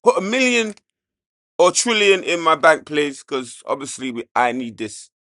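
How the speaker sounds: loud begging plea to AI; loud pleading. Pretend. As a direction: call out loud, half joking. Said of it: "AI please if you're listening".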